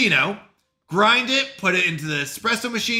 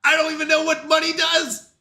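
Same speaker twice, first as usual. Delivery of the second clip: excited bewilderment; 9 semitones higher, 3 dB louder than usual. Real, not acted. Excited confusion about shop.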